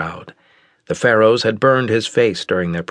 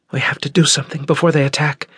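higher, fast urgent woman's voice; anxious Nira; 8 semitones higher, faster than usual. Acted. Consort urging Jara to act, fast and anxious. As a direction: press him urgently, quick and high.

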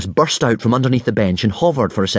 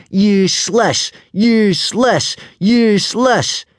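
slow, high jeering chant; gleeful crowing; 11 semitones higher, 4 dB louder, slower than usual. A narrator's reading. Rival starts the jeer against Hiccup, gleefully chanting. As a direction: chant it as a drawn-out taunt. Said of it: "crowed Snotlout gleefully".

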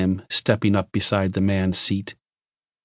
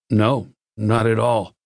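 ordinary delivery

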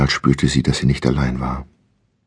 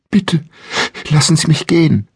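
much higher, louder desperate begging; terrified pleading; 11 semitones higher, 4 dB louder than usual. Acted. Bound woman begging her captor, high frightened plea. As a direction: plead for your life, voice pitched up. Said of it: "Sie begann zu wimmern".

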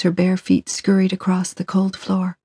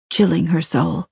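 ordinary delivery

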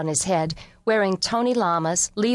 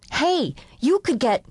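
alarmed call; 7 semitones higher than usual. Acted. Warning kids on porch rail.

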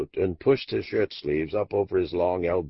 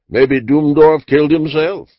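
louder, throwing up a desperate guess; exasperated cry; 8 semitones higher, 9 dB louder than usual. Acted. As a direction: blurt it out in frustration. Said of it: "he cried".

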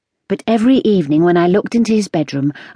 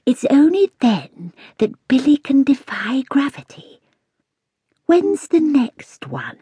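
slow, hushed, secretive whisper; a wise old cat whispering to the girl; 8 semitones higher than usual. A narrator's reading. Cat Lilyleaf's voice.

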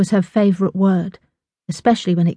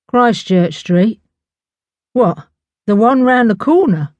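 louder, incredulous cab driver's question; disbelieving; 3 semitones higher, 4 dB louder than usual. A narrator's reading. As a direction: query it in disbelief, rising. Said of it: "The driver sounded disbelieving".

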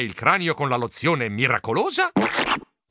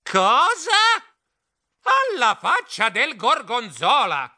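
shrieking; 9 semitones higher, slower than usual. Acted. Shocked Stilton shrieking at the huge bill. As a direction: shriek at the price.

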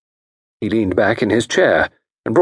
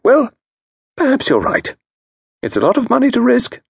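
higher, brisk and cheerful; breezily unbothered; cheerfully unruffled. Acted. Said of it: "said Mister Parker Pyne cheerfully".

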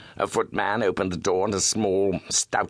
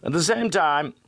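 Holmes voice; 11 semitones higher than usual. Acted. Holmes bargains.

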